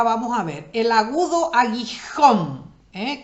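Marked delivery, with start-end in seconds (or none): 1.5-2.7 aguijón with a strong final JÓN; stress on last syllable. Shown as a demonstration. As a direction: land hard on the end of aguijón. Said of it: "lleva tilde en la última sílaba".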